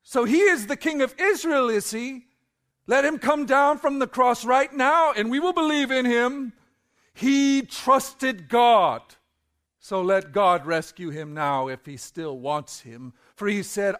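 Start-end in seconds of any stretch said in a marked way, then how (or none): 0.0-12.0 scoffing. Acted. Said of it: "they scoffed".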